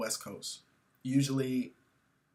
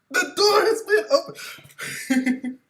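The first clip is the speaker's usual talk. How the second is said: high, loud pompous declaration; mock grand speech; 8 dB louder than usual. Comedian reprises his fake speech, grandly. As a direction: belt it like an awards speech.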